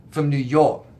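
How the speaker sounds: "New York" in a thick New York accent. Shown as a demonstration. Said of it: "You didn't like my New York accent?".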